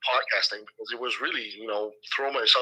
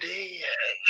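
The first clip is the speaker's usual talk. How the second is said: hammed-up French accent, higher; phony French accent; 5 semitones higher than usual. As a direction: put on a fake French accent. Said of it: "my phony French accent".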